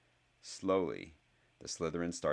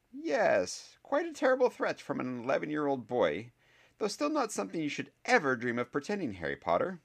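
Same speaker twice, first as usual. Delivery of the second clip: much higher, dry and reproachful; a sceptical teacher, higher and clipped; stern professor; 10 semitones higher, 4 dB louder than usual. Acted. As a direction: scold the boy with crisp authority. Professor Sprout voiced by narrator.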